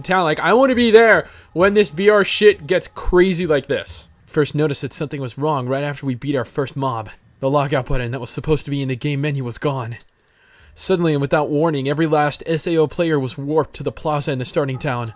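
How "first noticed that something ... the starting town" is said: softer, measured narrator read; story narration. Acted. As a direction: recount the ominous events like an anime prologue. Reads story narration aloud.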